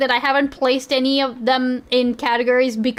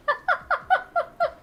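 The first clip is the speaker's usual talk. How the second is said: shrieking giggle, far higher; high squealing laugh; 4 dB quieter than usual. Real, not acted. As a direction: laugh helplessly at your own slip. Laughing at her own mistake.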